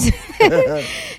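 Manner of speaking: a sudden high laugh; high, bright burst of laughing; burst of laughter. Real, not acted. Host laughs at listener's message.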